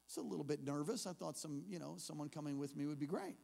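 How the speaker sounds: ordinary delivery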